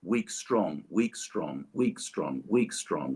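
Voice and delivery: weak, strong tapped out like a heartbeat; even da-DUM rhythm recited; iambic beat. Shown as a demonstration. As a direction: lean on every strong, drop every weak. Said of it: "it's a weak, strong beat".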